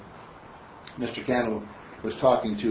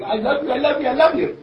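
put-on high, louder child's voice; little girl; 12 semitones higher, 5 dB louder, faster than usual. Little girl tells her mother she loves her, high. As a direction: gush it like a loving little girl. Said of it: "and she says".

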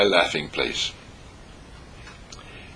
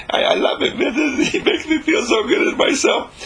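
high, giddy gushing with laughter; gushing admirer. Acted. Admirer praising the cool guy to himself, gushing and laughing. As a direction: gush adoringly through laughs, pitched way up.

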